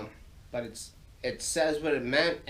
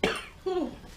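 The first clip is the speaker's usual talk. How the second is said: single harsh cough; coughing fit. Real, not acted. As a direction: cough to clear the throat. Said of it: "if you hear me hacking and coughing".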